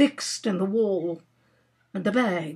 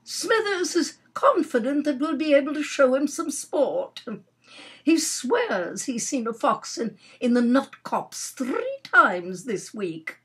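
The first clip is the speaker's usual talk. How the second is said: lightly voiced aunt, higher pitch; satisfied aunt; 6 semitones higher than usual. Aunt promising the Major sport, satisfied. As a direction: boast with a light hostess's assurance.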